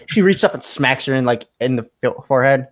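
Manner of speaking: usual speaking voice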